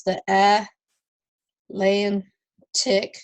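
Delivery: "a", "lan", "tick" as isolated sounds; teacher sounding out a word in pieces "a, lan, tick"; vowel sounds separated. Shown as a demonstration. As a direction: pause between "a", "lan" and "tick". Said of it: "three vowel sounds".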